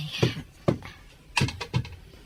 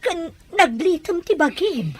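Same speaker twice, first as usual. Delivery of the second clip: louder, higher, fast worried questions; alarmed questions; 7 semitones higher, 6 dB louder than usual. Acted. Older sister alarmed at how thin and pale she is.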